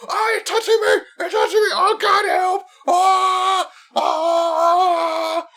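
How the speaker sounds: high, hammed-up shrieks; mock panicked yelp. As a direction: mimic a fake victim screaming. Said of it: "Like, go".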